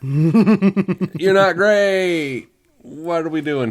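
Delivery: slow and loud, through laughter; laughing. Real, not acted. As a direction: laugh the words out. Laughing while roasting the actor.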